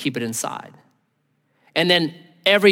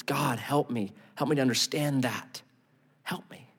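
softer, fading prayer for help; hushed earnest prayer; quiet plea; 2 semitones lower, 8 dB quieter than usual. Retold or imitated. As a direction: plead softly, trailing away. Voicing a prayer.

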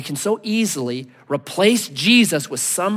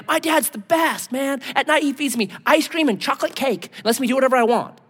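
put-on higher-pitched bragging child; a kid showing off about an indulgent parent, higher pitched; boasting kid; 6 semitones higher than usual. Kids telling everybody about their dad, boastful. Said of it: "you want your kids to say to everybody".

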